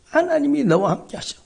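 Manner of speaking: "하나님이 너와 함께 하셔" rising high and full; high, ringing declaration. Shown as a demonstration. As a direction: proclaim it upward to the congregation. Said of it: "그 말을 저한테 들려주셔".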